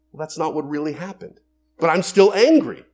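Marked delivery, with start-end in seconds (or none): 1.8-2.9 loud, higher-pitched burst of acted anger; angry protest. Spouse still upset after being corrected, angry protest. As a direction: insist on it, much louder and higher. Acting out someone who stays angry.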